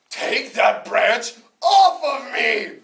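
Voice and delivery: very slow, high and loud stone shout; the stone bellows at the farmer; stone yelling. Acted. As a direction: stretch every word into a yell. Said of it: "the stone yelled at me".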